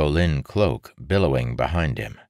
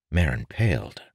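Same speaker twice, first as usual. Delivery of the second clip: ordinary delivery, nothing marked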